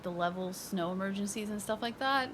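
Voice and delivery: ordinary talk, nothing marked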